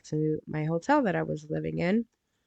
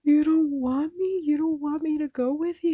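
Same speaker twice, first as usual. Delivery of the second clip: crying plea; 11 semitones higher than usual. Retold or imitated. To her boyfriend, fearing he rejects her, tearful high plea. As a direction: sob the question, hurt and rejected. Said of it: "and I cried my ball, my eyes out".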